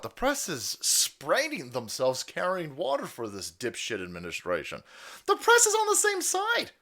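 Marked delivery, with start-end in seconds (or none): 5.3-6.7 much higher squeaky mock voice; hammed-up falsetto. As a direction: squeak it out mockingly. Mock reply to agents.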